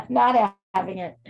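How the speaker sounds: cat's line cracking into laughter; laughing through the cat voice. Retold or imitated. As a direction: giggle while voicing the grumpy cat. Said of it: "He's like".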